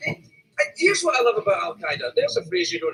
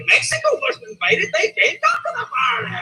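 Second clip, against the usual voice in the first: higher, laughing shout; laughing retort. Retold or imitated. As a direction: burst out laughing as you throw it back. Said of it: "We're like".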